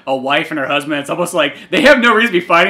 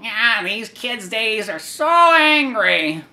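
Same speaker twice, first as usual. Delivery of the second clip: high, slow, lightly voiced old lady; a prim grandma tutting; 6 semitones higher, much slower than usual. Old lady complaining about the kids, high slow tut. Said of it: "the old lady's like".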